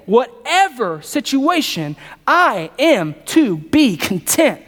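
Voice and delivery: whatever situation drawn out slowly; slow emphatic repeat. Shown as a demonstration. Preacher repeating the phrase slowly so the church catches it. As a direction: stretch out whatever situation and content. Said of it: "say that again".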